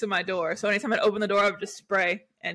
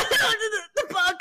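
choking; 12 semitones higher, slower than usual. Retold or imitated. Choking on bug, strained.

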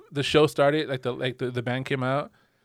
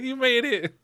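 laughing greeting; 10 semitones higher, slower than usual. Retold or imitated. Retold happy greeting at the pit.

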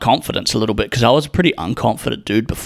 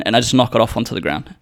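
ordinary talk

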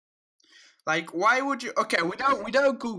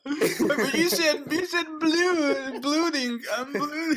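mock audience voice; 7 semitones higher than usual. Host playing audience calling out a slip, mock high voice. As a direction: put on a high-pitched nitpicking listener, stretching the oo. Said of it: "that's not your voice, audience".

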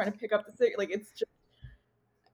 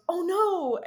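imitating a heartfelt, higher-pitched cry of dismay; earnest dismay; 8 semitones higher than usual. Retelling a film character's reaction to a thrown fish, earnest dismay. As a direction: say it high, in sincere alarm. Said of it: "really just earnestly goes".

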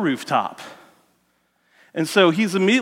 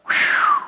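high whistled "whew"; long whistle. Retold or imitated. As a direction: let out a high whistle of trouble ahead. Whistles at David's bad prospects.

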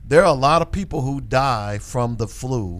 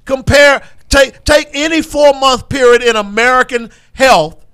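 raised, loud, exasperated insistence; heated rant; 8 semitones higher, 5 dB louder than usual. Real, not acted. Host arguing virus numbers with a co-host, really riled up. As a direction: argue it hard, voice pushed up high.

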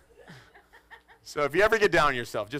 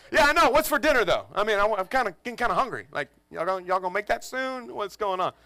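be unbothered and hungry; oblivious kid; 6 semitones higher than usual. Retold or imitated. Oldest son pestering dad about dinner, high and casual.

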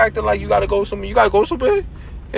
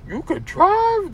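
mocking; 11 semitones higher, much slower than usual. Teasing friend's parking.

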